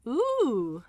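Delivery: high, loud impressed "ooh"; awed seamsters. Acted. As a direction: coo like a crowd of impressed tailors. Seamsters react in awe.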